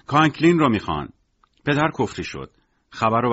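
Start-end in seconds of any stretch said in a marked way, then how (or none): 0.1-1.1 raised, louder and higher storyteller's cry; narrated group shout. A narrator's reading. The group demands Conklin in one voice, restrained narrated shout. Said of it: "گروهش یک صدا داد زدن".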